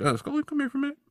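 Mulder calling Scully over. Acted. Mulder quietly calling Scully aside, high coaxing call. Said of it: "So Mulder's like".

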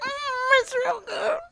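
small, high, soft imitation; mock enjoyment. Friend imitating her pretending to enjoy the sandwich, mock enjoyment. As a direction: squeak out unconvincing approval of the food.

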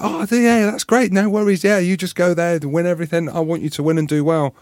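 high, sugary mock cheer; sarcastic mock support; fake enthusiasm. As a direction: pretend to be happy for them. Mock supportive attitude he rejects.

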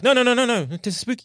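spooky voice. Retold or imitated. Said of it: "just kind of lean in a bit to be like".

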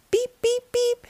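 high little beeps; small horn calling out; high repeated toots; truck horn beeps. A narrator's reading.

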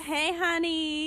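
high, slow mock Southern greeting; put-on Southern accent. Host answers co-host, mock Southern accent. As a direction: stretch it into a pretend Southern hello.

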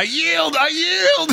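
high, panicked cries of surrender; still screaming. Acted. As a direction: keep shrieking for mercy. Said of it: "He is still screaming".

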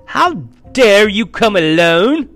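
slow, high, thundering outrage; imperious witch in a fury; terrible voice. A narrator's reading. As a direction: roar it at a disobedient servant. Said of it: "said the witch in a terrible voice".

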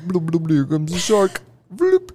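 playful bubbly bloops, rising high; gurgling fish man croak. Pretend. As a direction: bloop it out like a cartoon shark. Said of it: "I'm trying to paint them a picture".

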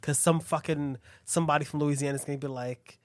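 ordinary talk, nothing marked

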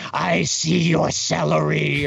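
slow, high, drawn-out mock yell; imitated yelling. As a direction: call nonsense into a building, stretching each word. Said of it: "you're just yelling into an apartment building".

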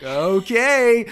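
mock host. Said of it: "Andy's just like".

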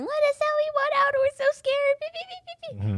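high whiny mock crowd voice; mocking panicked crowd. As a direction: squeal it like a silly scared mob. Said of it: "being all angy and stupid and naive".

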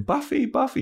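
high calling out of a name; mock yelling a friend's name; retold shout. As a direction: call out for Buffy from afar. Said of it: "He's screaming".

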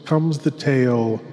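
put-on velvety old-time horror host; horror host intro. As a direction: lure the listener into the dark like a creepy host. Host introduces the radio tale.

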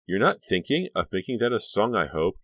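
dry. Acted. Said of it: "Caroline said dryly".

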